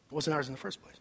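low, very soft repeat; hushed, low-pitched echo; very quietly. Real, not acted. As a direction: let it sink in softly. Repeats the point even softer.

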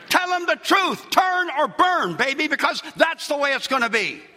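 bang the repeat home; preaching shout. Real, not acted. Pastor repeating his demand to congregation, shouting.

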